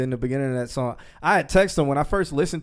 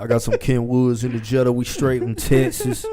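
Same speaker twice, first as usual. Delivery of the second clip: put-on Texas accent; 4 dB louder than usual. Joking with his co-host, a hammed-up Texas accent. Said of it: "That's my Texas accent".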